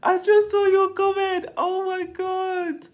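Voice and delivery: high, loud shocked squeal. Real, not acted. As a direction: squeal in disbelief. Reacting to comment, high squeal.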